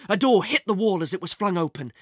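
ordinary delivery, nothing marked

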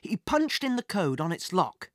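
plain reading voice

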